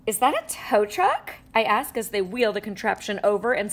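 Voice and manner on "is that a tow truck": ordinary delivery, nothing marked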